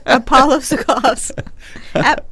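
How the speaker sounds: high, loud, through laughter; laughing out loud on the words. Real, not acted. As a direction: crack up while repeating the silly name. Co-host repeats it laughing.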